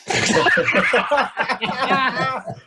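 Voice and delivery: high, loud laugh; burst of laughter. Real, not acted. Teased about free webinars.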